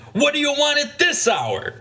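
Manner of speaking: high-pitched, irritated demand; indignant, strained high voice. A narrator's reading. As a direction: demand it like someone roused from bed.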